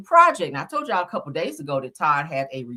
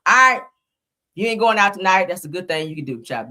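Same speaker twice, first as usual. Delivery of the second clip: ordinary delivery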